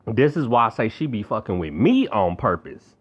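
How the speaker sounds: ordinary talk, nothing marked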